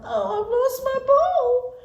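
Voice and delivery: high, slow wailing princess; high slow sad princess lament; crying princess. Acted. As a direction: whimper it like a spoiled princess. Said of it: "she began to cry".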